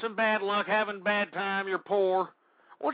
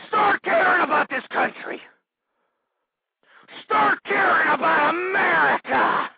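yelling, voice cracking with strain; desperate shout; 8 semitones higher, 7 dB louder than usual. Acted. Ranting host screaming at the country. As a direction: scream the plea like a last chance.

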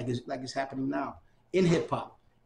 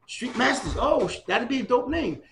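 higher, sudden burst of excitement when the name clicks; excited realization; 9 semitones higher, 4 dB louder than usual. Retold or imitated. Remembering the moment he found the name, excited. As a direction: land on it like a eureka moment. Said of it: "I said".

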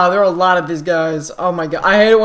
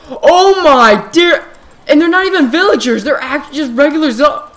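panicked high exclaim; 7 semitones higher, 6 dB louder than usual. Real, not acted. Swarmed by zombie mobs.

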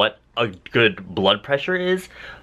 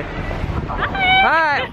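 much higher squealed hi; excited greeting. Real, not acted. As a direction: greet her with a delighted squeal.

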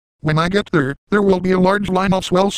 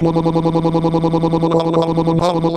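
a long rolling lo lo lo gargle; gargling noise. Retold or imitated. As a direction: gurgle it out like mouthwash. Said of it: "I will gargle Peter's semen like".

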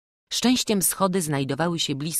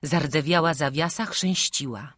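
plain reading voice

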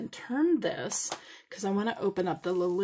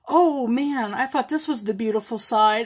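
excited exclaim; 9 dB louder than usual. Real, not acted. Unboxing a disappointing bead side.

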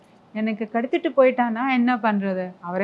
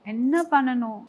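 usual speaking voice

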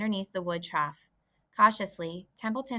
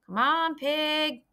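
louder, higher farmhand call; 8 semitones higher, 5 dB louder, much slower than usual. Acted. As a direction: holler at the pig to come eat. Farmhand calls the pig.

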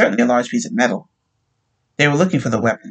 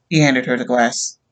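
usual speaking voice

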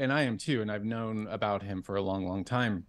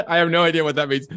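laughing, louder and quicker; laughing disbelief; 5 semitones higher, 10 dB louder, much faster than usual. Real, not acted. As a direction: blurt it out through a burst of laughter, fast and loud. Laughing at a baffling quote.

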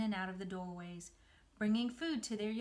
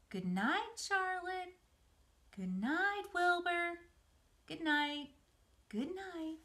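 echoing good nights; 10 semitones higher, 3 dB louder, slower than usual. Acted. Farewell echoing from many animals.

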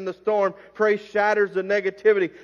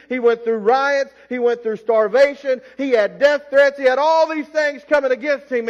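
louder, higher, driving preacher cadence; full-force preaching; 5 semitones higher, 5 dB louder than usual. Acted. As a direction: build the congregation up with each line.

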